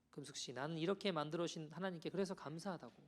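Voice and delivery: ordinary delivery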